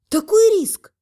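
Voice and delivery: high, loud burst of alarm. A narrator's reading. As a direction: cry out in disbelief. Heroine alarmed at husband's risk.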